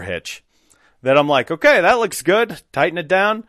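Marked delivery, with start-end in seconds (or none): 1.5-3.4 higher, louder, breezy okay; breezy self-approval. Retold or imitated. Installer approving his own hitch job, breezy self-approval. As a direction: sign it off with easy confidence.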